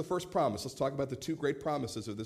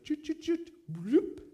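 genie magic sound; 13 semitones higher, slower than usual. Pretend. Rubbing genie lamp.

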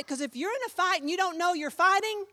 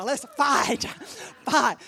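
mock brawler; 4 dB louder, slower than usual. Brother imagined eager to brawl.

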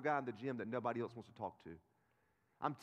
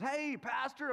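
higher, louder hail across the gym; imitating a guy calling out; mock call-out; 13 semitones higher, 5 dB louder, much slower than usual. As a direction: call out brightly to a friend across the room. Gym guy calls him pastor.

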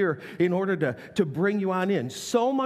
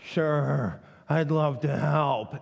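slow, grudging "Sure, I'd love to help"; unwilling yes, slow and flat; reluctant teen; 3 semitones lower, much slower than usual. Shown as a demonstration. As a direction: drag it out like a sulky thirteen-year-old. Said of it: "our thirteen-year-old can say".

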